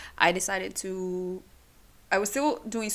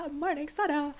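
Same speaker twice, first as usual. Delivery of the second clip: high, bright ta-da announcing the name; a little fanfare for the thesis partner; playful reveal; 8 semitones higher than usual. Real, not acted. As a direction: present him like a magic trick.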